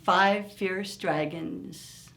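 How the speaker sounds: ordinary delivery, nothing marked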